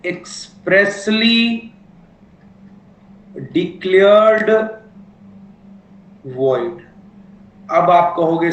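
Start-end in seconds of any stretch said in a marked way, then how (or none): 0.0-6.8 words drawn out with long gaps, very slow; slow dictation. Shown as a demonstration. Teacher to class, giving point nine for notes, very slow dictation. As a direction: dictate it so students can write along.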